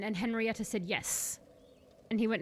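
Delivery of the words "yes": quiet yes. Acted. Witch answers coolly.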